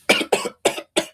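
sudden coughing fit; choking on air. Real, not acted. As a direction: cough hard, throat caught. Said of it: "I'm choking on air".

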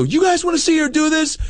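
rile up the audience; hype host shout. Retold or imitated. Host firing up the crowd.

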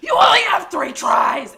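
high, loud, slow bark of a warning; mock screamed airport warning; screaming clerk. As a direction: yell it like a strict official. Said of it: "literally was screaming at me".

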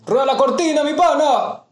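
high, loud announcer call; hype shout. Acted. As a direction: call it out like a show host. Show opener catchphrase shout.

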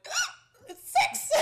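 high-pitched, loud, laughing yelp of alarm; startled realization. Retold or imitated. Retelling how she noticed the late hour, a laughing shocked squeal. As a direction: squeal it out in mock panic at the clock. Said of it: "I'm like".

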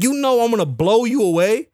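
retold threat, pitched well up; mock threat. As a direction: threaten him in a high, disbelieving voice. Acting out threat to the challenger.

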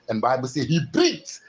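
driving, building sermon chant; full-force preaching. Acted. Preacher voicing God's life breathed into man, impassioned preaching. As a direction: fire off repeated phrases, swelling to a cry.

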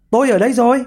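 high, light call. A narrator's reading. Hedgehog teaching his wife what to shout, calling out. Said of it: "thì nhà chỉ việc kêu lên".

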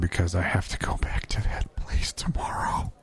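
whispered dread. Real, not acted. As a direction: whisper it under your breath. Dreading going back to work.

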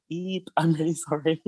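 high, small cringing voice; squeamish apology. Retold or imitated. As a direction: shrink from their glares in a high pitch. Acts shrinking under family's evil looks.

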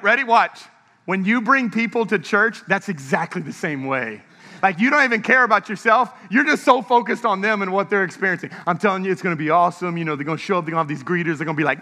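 ordinary delivery

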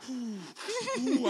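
high, forceful blown-out breaths; pained puffing. Retold or imitated. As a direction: puff through the pain, trying not to cry. Puffing to take the pain.

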